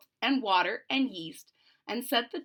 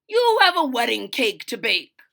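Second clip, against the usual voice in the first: louder parting reminder; calling back; 9 dB louder than usual. Acted. Father calling back a chore, louder. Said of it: "he called back".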